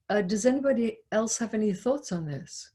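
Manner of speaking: ordinary talk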